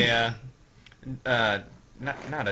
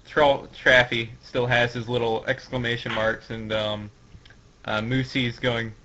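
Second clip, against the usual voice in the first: normal talking voice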